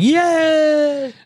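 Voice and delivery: high squealed yay; mock cheer. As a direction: squeal it like a kid. Mock cheer of getting rich.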